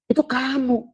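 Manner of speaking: high, soft, tearful break; crying. Acted. Disciple realizes the truth, weeping to his teacher. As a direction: voice cracking into a sob.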